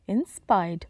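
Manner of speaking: ordinary delivery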